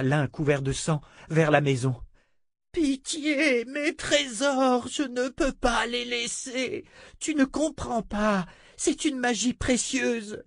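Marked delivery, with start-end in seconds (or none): 2.7-10.4 high, slow, anguished plea; desperate pleading. Acted. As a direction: beg for your lost treasures. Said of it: "Adi hurlait comme une mère".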